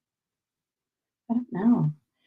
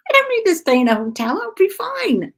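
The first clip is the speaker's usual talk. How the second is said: insistent protest; 12 semitones higher, 7 dB louder than usual. Retold or imitated. Insisting to her dad, retold.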